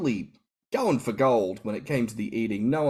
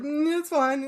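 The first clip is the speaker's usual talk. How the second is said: much higher, breezy brush-off; shrugging it off; 3 dB louder than usual. Retold or imitated. Host shrugging off the massage, high and breezy. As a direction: toss it away lightly. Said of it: "just kinda shrugged it off".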